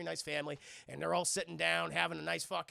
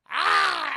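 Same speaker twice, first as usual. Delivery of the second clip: angry yell; 11 semitones higher, 14 dB louder than usual. Acted. Father about to slap his son.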